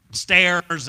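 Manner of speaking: normal talking voice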